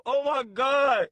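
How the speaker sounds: slow, loud oh-my-God; overwhelmed exclamation. Real, not acted. Streamer reacting to a hilarious clip, loud drawn-out disbelief. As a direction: draw it out loudly after a laughing fit. Recovering from hysterical laughing.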